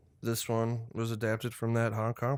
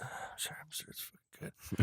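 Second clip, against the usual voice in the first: breathy whispered mutter; whispered aside; 4 semitones higher, 10 dB quieter than usual. Real, not acted. Whispering an aside to friends about the budget.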